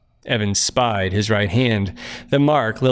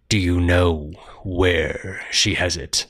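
low looming threat; 4 semitones lower, slower than usual. Acted. Stranger presses Evan.

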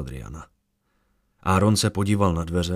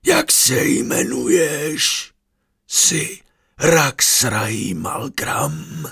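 eerie questioning spirit; 6 semitones higher, much slower than usual. A narrator's reading. The mind-voice questions him.

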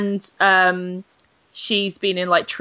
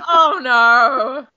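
louder, higher wail of dismay; mock horror; 5 semitones higher, 6 dB louder than usual. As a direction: moan it out in exaggerated horror.

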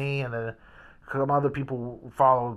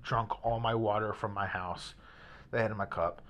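normal talking voice

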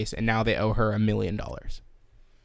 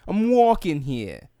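high, very stretched New York vowels; New York accent; 6 semitones higher, slower than usual. Shown as a demonstration. Host practising the classic line, drawn-out New York accent. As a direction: drag it out like a New Yorker. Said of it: "in a New York accent".

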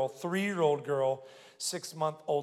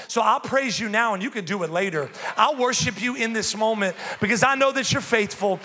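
raised, driving preacher's cadence; preaching at full force; 4 semitones higher, 4 dB louder than usual. Acted. Pastor to congregation, driving preaching. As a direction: build the praise with conviction.

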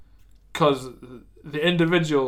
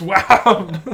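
loud wham; 4 semitones higher, 9 dB louder than usual. Acted. T-Rex smashing the elf.

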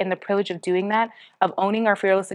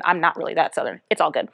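ordinary delivery

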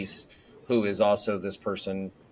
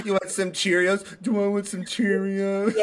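mock repeating, pitched higher; mimicking the kid; 9 semitones higher than usual. Mom imitating her daughter's mocking echo, higher. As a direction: echo her words back like a smartass toddler. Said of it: "it sounds like it's in a mocking tone".

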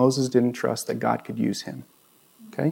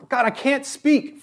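much higher mock plea; pleading Moses; 13 semitones higher than usual. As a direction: Moses squeaking out his last excuse. Moses pleading in retold scene.

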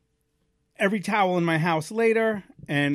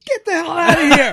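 high, very loud comic outburst; exasperated yell; 13 semitones higher, 13 dB louder than usual. Retold or imitated. Dad yelling at his son's useless help, exasperated shout. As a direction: explode in mock fury.

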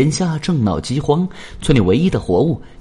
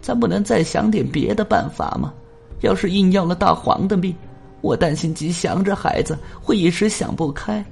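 worried mother; 6 semitones higher than usual. A narrator's reading. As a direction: plead with her husband, anxious. Mother worries to husband at night.

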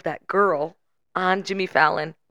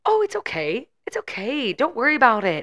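high, put-on laugh-it-off voice; high mock reassurance; imitating forced reassurance; 6 semitones higher than usual. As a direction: imitate a host brushing it off, pitched up. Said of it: "trying to laugh it off".

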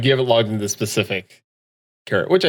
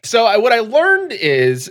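high and very slow; slow dramatic build-up; 9 semitones higher, slower than usual. Real, not acted. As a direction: drag it out like a big reveal. Grand, drawn-out setup.

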